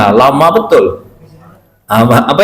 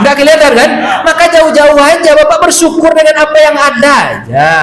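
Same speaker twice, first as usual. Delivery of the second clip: raised, higher preaching call; preaching shout; 6 semitones higher than usual. Real, not acted. Preacher urging listeners to be grateful, shouting. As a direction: push it out to the back row.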